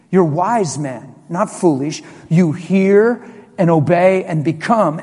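ordinary talk

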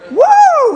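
very high, loud joyful woo with a laugh; joyful whoop. Real, not acted. Pastor reliving the baptism moment, joyful whoop. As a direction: let out a big happy holler.

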